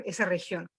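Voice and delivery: ordinary delivery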